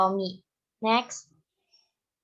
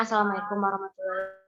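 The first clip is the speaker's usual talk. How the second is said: radio breaking up; 5 dB quieter, much faster than usual. Real, not acted. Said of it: "Suaranya hilang ya?".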